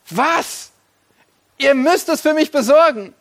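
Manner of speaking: the tycoon flaring up at his helpers; indignant outburst. Acted. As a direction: bark the order in disbelief. Rich man outraged at servants.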